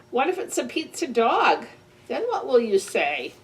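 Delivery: plain reading voice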